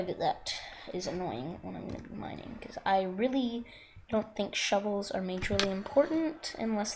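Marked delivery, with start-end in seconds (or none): 0.0-2.8 real whispered apology, all breath; hushed breathy aside to viewers. Real, not acted. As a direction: whisper it close to the mic. Apologises while mining noise.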